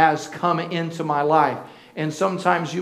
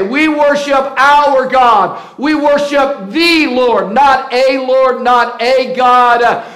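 loud, slow, fervent preaching; full-force preaching; 5 semitones higher, 7 dB louder, slower than usual. Acted. Pastor preaching to the congregation, loud and fervent. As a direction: proclaim it to the congregation, slow and strong.

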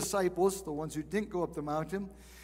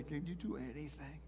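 hushed, much softer pleading question; desperate plea; 4 semitones lower, 12 dB quieter than usual. Retold or imitated. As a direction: beg quietly, almost out of hope. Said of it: "you hear this desperate plea".

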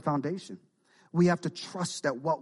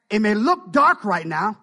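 loud; 6 semitones higher, 10 dB louder, slower than usual. Real, not acted. Preaching build.

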